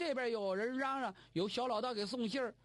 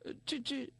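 flustered stammer; 5 semitones higher than usual. A narrator's reading. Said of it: "听完之后脸腾一下红了".

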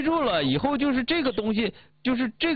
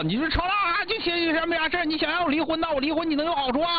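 acted ranting, pitched higher; 吵吵闹闹; 5 semitones higher than usual. Shown as a demonstration. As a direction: demonstrate picking a noisy fight, higher voice. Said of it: "一顿吵吵". (假装吵闹，调门拔高)

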